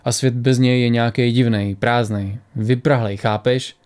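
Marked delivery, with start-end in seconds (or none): none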